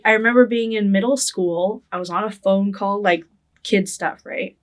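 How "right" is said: usual speaking voice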